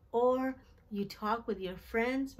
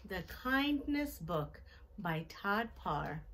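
put-on reading to children. Teacher reading a picture book to children, even and gentle. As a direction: read each page gently to the class.

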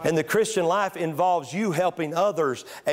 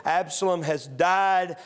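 usual speaking voice

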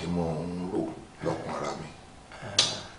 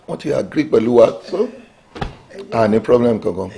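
much louder, confident boast; boastful; 4 semitones higher, 7 dB louder than usual. Acted. Politician boasting to Honorable about bribes, loud boast. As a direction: crow about buying everyone off.

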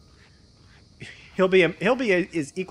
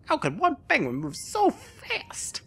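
high voice; slower than usual. Acted. Reading game text aloud.

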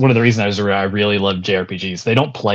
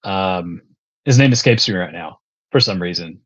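ordinary talk, nothing marked

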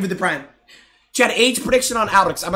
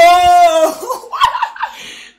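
loud laugh; 11 semitones higher, 7 dB louder than usual. Real, not acted. Big laugh at reply.

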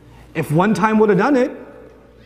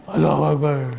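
crowd response; 7 semitones lower than usual. Acted. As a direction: answer the call low, like a congregation. Crowd's answer to the takbir.

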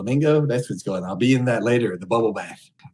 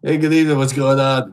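loud, high leering lounge-lizard greeting; sleazy greeter; 4 semitones higher, 9 dB louder than usual. Acted. Streamer greets Omegle strangers as a leering creep, loud and high. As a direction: belt it out like a creepy host hitting on strangers.

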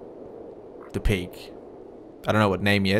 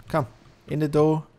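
calling out; 6 semitones higher, 4 dB louder than usual. Real, not acted. Calling animal into the door.

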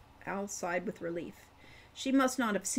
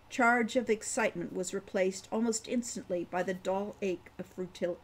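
usual speaking voice

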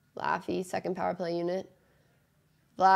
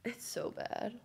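muttered; 3 semitones higher, 11 dB quieter, slower than usual. Real, not acted. Repeats line, first soft.

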